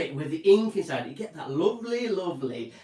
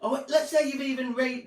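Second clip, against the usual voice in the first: ordinary talk, nothing marked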